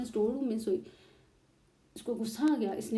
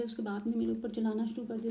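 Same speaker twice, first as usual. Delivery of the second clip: ordinary talk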